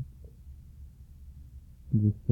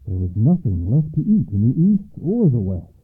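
ordinary delivery, nothing marked

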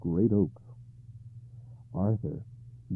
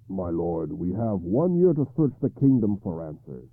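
steady, confident, a bit louder; confident knight; 8 dB louder than usual. Acted. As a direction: reassure your king firmly. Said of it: "said Gawain confidently".

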